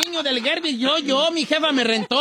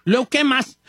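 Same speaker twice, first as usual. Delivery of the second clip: ordinary delivery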